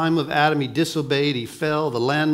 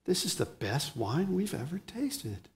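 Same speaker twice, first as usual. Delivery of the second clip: awed, much softer, almost breathed; hushed amazement; 11 dB quieter than usual. Retold or imitated. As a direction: marvel under your breath. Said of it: "everyone gasped and said".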